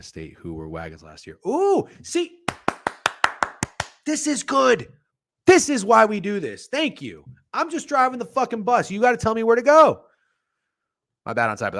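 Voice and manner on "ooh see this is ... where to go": very high-pitched, excited exclaiming; real excitement, pitched way up; excited outburst. Real, not acted. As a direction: cry out in high-pitched delight at the help. Thrilled by chat's betting tip.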